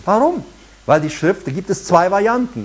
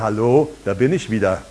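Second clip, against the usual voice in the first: lower, sly greeting as Fear; lightly voiced mock menace; fear personified; 6 semitones lower than usual. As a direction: play Fear as a smug old acquaintance. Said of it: "wird die Angst dich anschauen und wird sagen".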